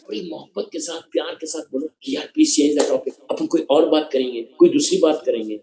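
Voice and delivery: a tender low voice asking to change the topic; very softly. Shown as a demonstration. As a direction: coax them gently off the topic. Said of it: "very softly".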